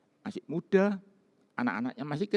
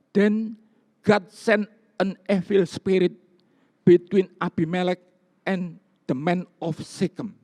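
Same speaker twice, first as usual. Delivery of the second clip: slow, louder English reading of the verse; English scripture reading; 4 semitones higher, 5 dB louder than usual. Acted. Pastor reads the King James verse to his church, in a slow raised reading voice. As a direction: proclaim each English word from the pulpit.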